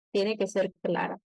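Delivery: ordinary talk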